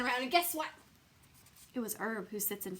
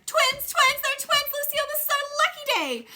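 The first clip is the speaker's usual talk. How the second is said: much higher and louder, fast gleeful squeal; ecstatic; 10 dB louder than usual. A narrator's reading. Junie B jumping for joy at the twins, shrill excited squeal. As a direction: squeal like it's the best day ever.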